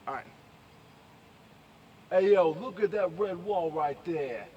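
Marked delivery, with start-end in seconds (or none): 2.1-4.4 put-on loud, slow, higher New York accent. Vlogger workshopping his Bronx voice, loud New York accent. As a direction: call it out in hammed-up Bronx. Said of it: "I'm from New York".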